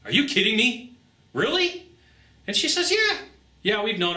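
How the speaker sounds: retold shock, pitch rocketing up on the last word; stunned disbelief. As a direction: voice leaps high on a sharp "Really?". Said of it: "I went".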